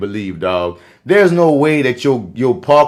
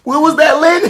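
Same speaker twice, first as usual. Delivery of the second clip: laughing at her. Retold or imitated. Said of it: "Say that one more time".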